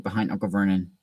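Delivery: plain reading voice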